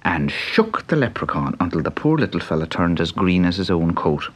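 plain reading voice

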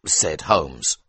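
ordinary delivery, nothing marked

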